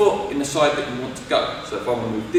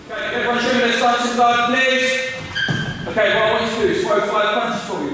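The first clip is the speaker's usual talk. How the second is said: raised, higher coaching voice; calling out to class; 8 semitones higher than usual. Real, not acted. Coach giving the class a drill, calling out. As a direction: call the drill across the room.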